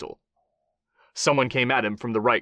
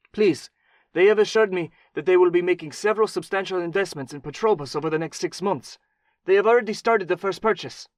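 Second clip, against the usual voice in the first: higher, strained pleading voice; desperate Mexican man; 8 semitones higher, 3 dB louder than usual. A narrator's reading. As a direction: beg for your life. Wounded man begs.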